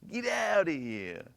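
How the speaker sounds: drawled-out Jersey dismissal; light New Jersey tough-guy voice; New Jersey accent. Retold or imitated. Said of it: "in a New Jersey accent".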